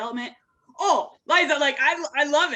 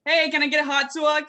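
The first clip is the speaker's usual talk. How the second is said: higher-pitched New Yorker; New York accent; 5 semitones higher than usual. Shown as a demonstration. Podcast guest showing off her New Yorker to the host, exaggerated New York accent. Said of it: "I can do a pretty good New Yorker".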